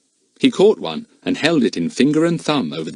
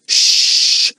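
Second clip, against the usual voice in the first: parrot shush; 6 dB louder than usual. A narrator's reading. Kiki the parrot hushes.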